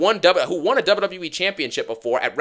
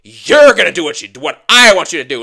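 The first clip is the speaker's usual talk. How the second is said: hammed-up angry shout; mock yell; 9 dB louder than usual. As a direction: bark it like a bully ordering someone around. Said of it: "He's like".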